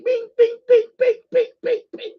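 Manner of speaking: high, quick sing-song "bing" sounds; mock pen signing. Mimicking the president signing orders, high sing-song bings. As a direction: chirp each bing like a pen stroke.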